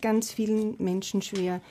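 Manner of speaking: usual speaking voice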